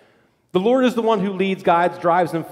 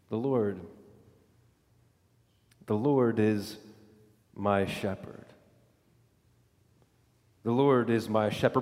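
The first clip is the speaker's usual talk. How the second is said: soft proclamation; 10 semitones lower, 8 dB quieter than usual. Acted. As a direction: speak it like a quiet vow of trust. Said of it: "it first starts with a proclamation".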